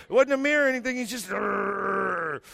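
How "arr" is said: long, steady held growl; drawn-out mock dog growl; dog growl imitation. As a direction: growl like an old dog at a wall. Imitates old dog growling at wall.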